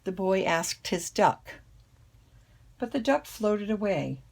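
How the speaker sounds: ordinary delivery, nothing marked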